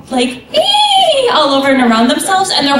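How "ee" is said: very high, sustained "eee"; little imitated creature squeal; high squeal imitating kiraras. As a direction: squeal like a tiny flying creature. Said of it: "they have the little kiraras going".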